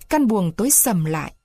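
usual speaking voice

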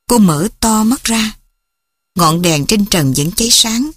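ordinary delivery

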